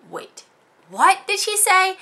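high, loud, slow double take; stunned disbelief. Retold or imitated. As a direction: replay the shock of hearing it. Shocked at pedicurist's remark.

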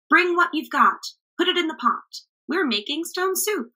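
high, eager rallying chant; rally the crowd to chip in; cheerful call. A narrator's reading. Travelers urging the villagers to bring food, cheerful call. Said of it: "shouted the travelers".

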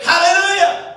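a bright outburst pitched high; joyful hallelujah. Retold or imitated. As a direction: shout it out like you can't help it. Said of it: "if you said".

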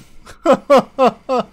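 loud, high belly laugh; big laugh. Real, not acted. Caster cracking up at the botched play, loud laughing. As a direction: burst out laughing for real.

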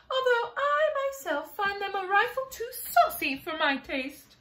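lofty ladybug judging her relatives, pitched high; high, snooty ladybug. Acted. Ladybug gossips to James about relatives, high and snooty.

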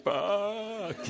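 high, drawn-out mock bawl; one long high-pitched "waah" of pretend crying; imitated crying wail. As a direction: burst into an exaggerated wail, imitating her sobs. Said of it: "She was really crying at that point".

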